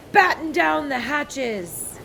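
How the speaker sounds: loud, high, slow shouted order; storm shout. A narrator's reading. Captain orders the crew in the storm, loud slow shout. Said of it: "Braidbeard shouted".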